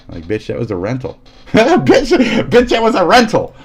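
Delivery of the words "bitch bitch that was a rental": high, loud laughing outburst; mock outraged yell. Mocking the friend over the snapped rental, high and loud. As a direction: shriek it at the friend who broke the disc. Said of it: "they're gonna take your disc and go".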